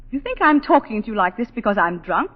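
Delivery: ordinary talk, nothing marked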